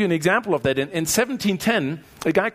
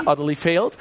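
usual speaking voice

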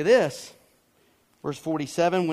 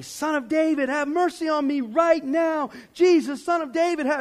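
high-pitched, raised shout; retold yell; 10 semitones higher, 5 dB louder than usual. Preacher retelling how he yelled while teaching this passage, high shout. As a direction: yell out the plea urgently, high and loud. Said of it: "and he, and he shouted".

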